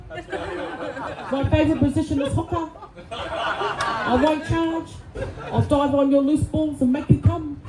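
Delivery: high, breathy-slow mock phone-sex voice in a thick New Zealand accent; sexy New Zealand Māori accent. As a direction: purr it like a Kiwi phone sex operator, hammed-up. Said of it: "this accent got voted the sexiest accent in the world".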